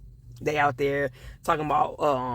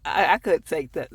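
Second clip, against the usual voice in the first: ordinary talk, nothing marked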